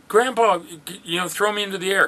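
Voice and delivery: put-on high, excited child's plea; a grandpa mimicking a small child asking to be thrown; grandkid voice. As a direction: beg like an eager little grandkid. Grandkids begging grandpa to throw them.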